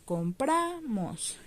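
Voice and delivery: compramos very slow, the PRA held long; com-PRAAA-mos, middle syllable drawn out; stress on middle syllable. Shown as a demonstration. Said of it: "en dónde lleva el acento".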